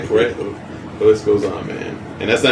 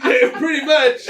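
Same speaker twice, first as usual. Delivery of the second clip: high laughing impression. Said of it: "I was like".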